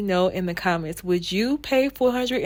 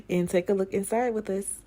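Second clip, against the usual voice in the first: ordinary talk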